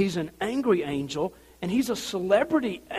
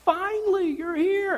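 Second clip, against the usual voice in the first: much higher, slowed excited call; crowd greeting; 14 semitones higher, 3 dB louder, slower than usual. Retold or imitated. People greeting Zechariah at the temple, high and eager. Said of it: "the people go".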